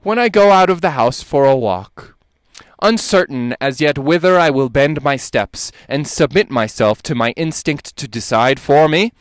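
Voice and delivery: normal talking voice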